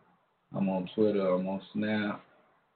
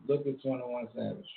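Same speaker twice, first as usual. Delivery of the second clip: normal talking voice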